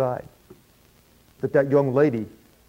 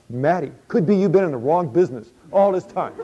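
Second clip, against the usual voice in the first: laughing; 3 semitones higher than usual. Acted. Matty joking to himself about his trade, laughing. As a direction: chuckle the thought out.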